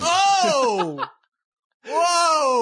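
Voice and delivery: loud, high, shocked exclamation; startled yelp. Retold or imitated. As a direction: yelp like you got jolted. Husband jolted by anus remote.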